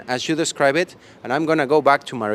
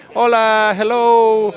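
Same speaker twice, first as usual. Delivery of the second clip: excited greeting; 8 semitones higher, 8 dB louder, slower than usual. Real, not acted. Greeting people at the tables.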